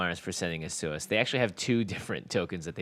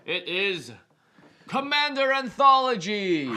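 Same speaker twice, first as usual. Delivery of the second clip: excited reveal; 11 semitones higher, 5 dB louder, slower than usual. Real, not acted. Unboxing reveal of product.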